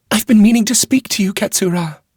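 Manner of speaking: a worried court lady relieved to see him; anxious noble lady. A narrator's reading. Wheeler voiced in the story.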